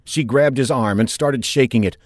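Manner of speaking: ordinary delivery, nothing marked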